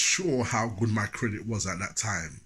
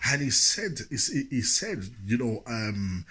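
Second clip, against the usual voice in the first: ordinary talk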